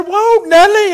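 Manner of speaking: high, loud holler to a horse; panicked rider calling. Acted. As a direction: cry out to halt a bolting horse. Rider trying to stop runaway horse.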